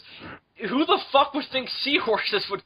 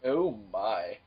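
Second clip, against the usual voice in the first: ordinary talk, nothing marked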